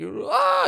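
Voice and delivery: high shriek; startled high-pitched shriek; bear scream. Pretend. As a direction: let out a sudden high shriek. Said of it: "it's like".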